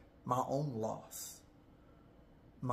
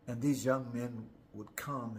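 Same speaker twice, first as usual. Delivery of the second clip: ordinary talk, nothing marked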